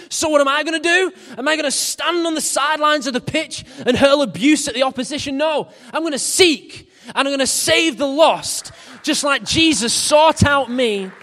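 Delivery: high, loud, fast preaching; full-force preaching. Acted. Preacher telling the church he will seek the lost, at full force. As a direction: drive it home to the congregation.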